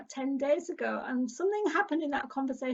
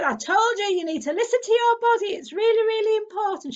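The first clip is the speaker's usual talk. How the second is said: higher and louder, a mum's firm telling-off; 8 semitones higher, 9 dB louder than usual. Retold or imitated. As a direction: imitate her mother scolding her. Mum telling her off.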